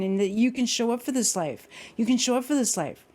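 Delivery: high, fast, rousing motivational presenter; loud opening, relentless high-pitched pep talk; Ernie Larson. Retold or imitated. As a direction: fire questions and urgings at the audience. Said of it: "open his course with".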